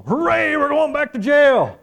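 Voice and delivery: high, slow, sing-song mock cheer; a hammed-up gleeful hooray; mock cheerful. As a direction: sell it like jail is a treat. Apostles mock-cheering about jail.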